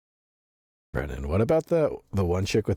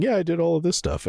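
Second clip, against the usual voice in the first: higher, airy mock confession; 11 semitones higher than usual. Mimicking the streamer's cheerful opening admission. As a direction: chirp it like owning everything happily. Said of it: "he just starts the video by going".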